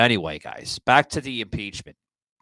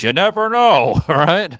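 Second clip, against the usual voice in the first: laughing; 7 semitones higher, 12 dB louder than usual. Real, not acted. Laughing at own joke.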